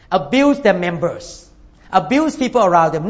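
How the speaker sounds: ordinary delivery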